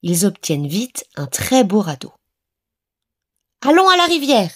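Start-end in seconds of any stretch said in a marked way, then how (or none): 3.6-4.5 put-on high, loud, bright call of a child; child shouting with excitement to go; eager exclamation. A narrator's reading. Enfant pressé de partir au radeau, cri enthousiaste. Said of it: "s'exclame l'enfant".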